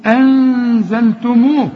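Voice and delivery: slow أنزلتموه, hummed ن held before ز; hidden nasalised nun. Shown as a demonstration. Teacher models ikhfa for students, held nasal hum. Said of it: "اكفي وغن هنا".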